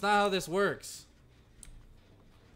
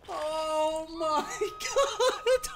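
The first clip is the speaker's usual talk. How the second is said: much higher, very slow, broken by laughs; laughing; 9 semitones higher, much slower than usual. Real, not acted. Streamer to chat after the fail, laughing slowly. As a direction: let the laugh split the words.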